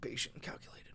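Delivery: breathless whisper at the end; hushed, choked-up whisper. Real, not acted. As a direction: let the last words fall to a whisper. Reading ends, overcome.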